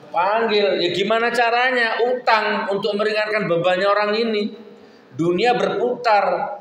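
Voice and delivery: preacher building to a climax; fiery preaching. Acted. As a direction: pitch climbs high as the sermon peaks. Preacher rises to full force.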